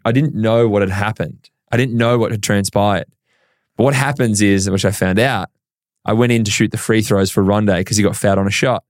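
usual speaking voice

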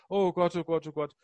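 gespielt verzweifeltes Klagen, lauter und höher; jammerndes Oh Gott. Retold or imitated. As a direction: halb im Spaß jammern, als wäre alles verloren. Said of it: "Und dann immer ich sage". (ein Mann äfft sein eigenes Jammern nach, gespielt verzweifelt)

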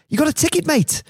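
high, pushed Scouse-fan shout; mock high-pitched punter's yell; drunk fan calling out. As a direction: call out hopefully to a stranger, high-pitched. Drunk fan calls out to player.

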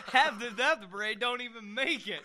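can't stop laughing; real laughing. Real, not acted. Laughing at the pub parade.

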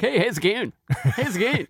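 high, breezy hello; cheery young guard. Retold or imitated. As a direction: greet him like an overfriendly kid. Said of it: "'cause he was like".

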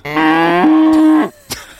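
loud, high villain cackle; evil cackle. Acted. Evil laugh after line.

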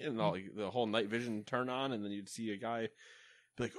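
ordinary delivery

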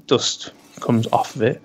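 normal talking voice

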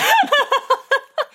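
high-pitched burst of laughter. Real, not acted. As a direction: crack up high. Laughing at article.

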